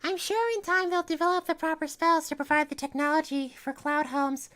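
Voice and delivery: reassuring Twilight. Acted. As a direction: reassure a friend. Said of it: "reassured Twilight".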